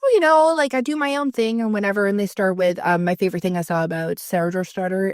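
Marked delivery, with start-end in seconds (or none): 0.0-1.6 mock. Mocking self-styled independent people.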